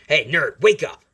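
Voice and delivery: loud, high yell at a sleeper; shouted wake-up. Acted. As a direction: jolt the sleeper awake. Bully yells to wake sleeping classmate.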